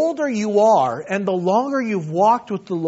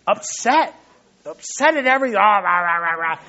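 lightly imitated grumbling old person; mock grumpy ranting. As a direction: mimic someone griping at everything. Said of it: "they're sharp and sometimes just".

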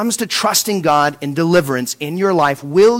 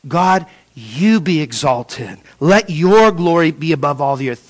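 prayerful; 4 semitones lower than usual. Acted. Worshipful prayer.

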